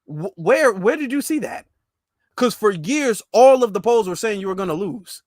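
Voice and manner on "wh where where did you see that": incredulous question. Retold or imitated. Challenging [name], incredulous.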